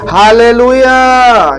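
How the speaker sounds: high, loud and stretched slowly; a jubilant preacher's call; long shouted hallelujah. Real, not acted. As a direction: cry it out to the crowd.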